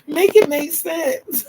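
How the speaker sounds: high, loud, slow words through laughter; cracking up at your own absurdity; real laughter. Real, not acted. Laughing at herself.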